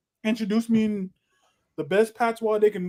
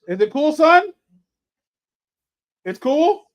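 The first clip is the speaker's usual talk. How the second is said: calling out; 9 semitones higher, 6 dB louder than usual. Real, not acted. Asks child loudly.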